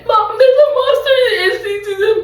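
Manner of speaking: put-on high, fast, sobbing kid voice; scared child crying. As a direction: panic like a crying little girl. Voicing scared child Holly.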